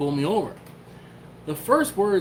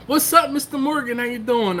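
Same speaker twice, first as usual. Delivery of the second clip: excited greeting; 10 semitones higher, 5 dB louder than usual. Acted. Greeting a viewer by name.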